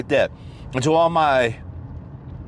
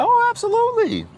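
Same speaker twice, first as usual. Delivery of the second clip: emphatic absolutely. Real, not acted. Answering own rhetorical question, emphatic high oh. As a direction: sing out the agreement.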